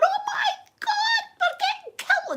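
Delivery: high laughing squeal of fake terror; mock panic squeal. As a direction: shriek it half-laughing. Retold panic, car shaking.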